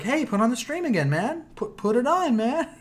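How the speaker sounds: a hint of a pushy little boy; imitating the kid. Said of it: "he was just like".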